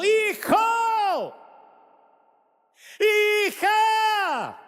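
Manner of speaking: soaring desperate call; God calling out. Acted. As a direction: cry out to a lost child. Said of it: "la voz de Dios diciendo".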